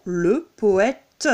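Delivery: poète ending in an audible "teu"; sounded final E. Shown as a demonstration. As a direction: voice the E at the end of poète. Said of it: "on l'entend".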